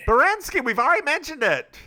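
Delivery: high, loud exasperated correction; exasperated reminder. Pretend. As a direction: snap back in hammed-up exasperation. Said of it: "I was saying it for the audience".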